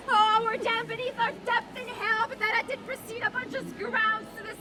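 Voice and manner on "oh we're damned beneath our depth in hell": normal talking voice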